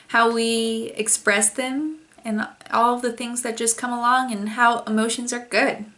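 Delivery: ordinary delivery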